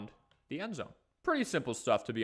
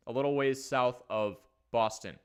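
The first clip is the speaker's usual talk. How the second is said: ordinary talk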